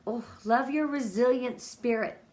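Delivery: usual speaking voice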